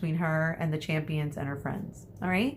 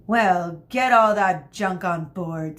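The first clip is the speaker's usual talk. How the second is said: louder, curt scolding; snapped; 4 dB louder, slower than usual. Acted. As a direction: bark it at the slow child. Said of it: "snapped Miss Medea".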